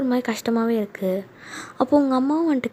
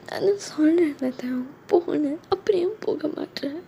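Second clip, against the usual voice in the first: high, soft tearful plea; crying; 5 semitones higher, 4 dB quieter than usual. Acted. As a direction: beg him to leave through acted tears. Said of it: "ஏன்டி அழுகுற?".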